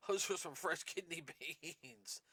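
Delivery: laughing through the words. Real, not acted. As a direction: chuckle as you say it. Laughs at his own burping.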